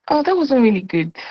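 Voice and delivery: high, deflated take on your own voice; discouraged self. Acted. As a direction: let it sag in disappointment.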